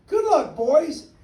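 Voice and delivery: high, slow farewell call; calling goodbye to the departing creatures; cried out. A narrator's reading. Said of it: "he cried".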